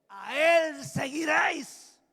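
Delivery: loud, drawn-out proclamation; preaching shout. Real, not acted. Preacher commanding the congregation to follow God, loud drawn-out shout. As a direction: shout it slowly over the congregation.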